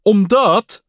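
high, loud wailing whine; loud high whimpering whine; whining. A narrator's reading. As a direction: wail it like a fretful child. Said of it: "jammerde Pat".

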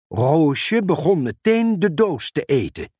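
usual speaking voice

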